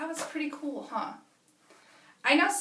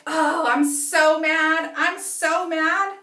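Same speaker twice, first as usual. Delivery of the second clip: loud, slow, stretched complaint; mad; 3 semitones higher, 9 dB louder, much slower than usual. Acted. Lila telling the class she is mad, loud and slow. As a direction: fume it out slowly. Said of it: "She's like".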